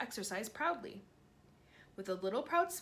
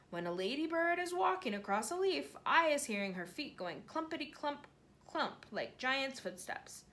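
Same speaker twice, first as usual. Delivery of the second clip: higher, wondering giant voice; a giant marvelling at tiny things; lightly voiced awed giant; BFG voice; 5 semitones higher than usual.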